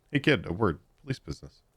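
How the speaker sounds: ordinary talk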